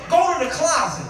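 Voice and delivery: usual speaking voice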